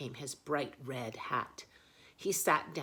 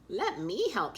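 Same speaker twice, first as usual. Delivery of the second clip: high, eager little offer; hare voice; 7 semitones higher, 7 dB louder than usual. Acted. Hare offers to help, high and eager. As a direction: squeak it like a quick hare. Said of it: "she says".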